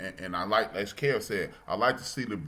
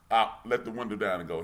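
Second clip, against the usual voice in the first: ordinary delivery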